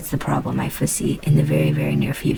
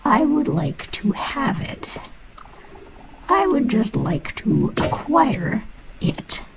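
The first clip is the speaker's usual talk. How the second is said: slow, breathy whisper begging for the iron ore; pleading whisper; 9 semitones higher, slower than usual. Acted. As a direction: coax it out in a hushed whisper.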